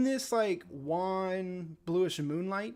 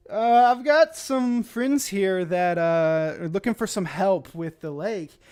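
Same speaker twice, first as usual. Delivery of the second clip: louder, higher deadpan aside; Theo's voice; 3 semitones higher, 10 dB louder, slower than usual. Acted. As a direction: speak up to the bugs with a sly look. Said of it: "he says".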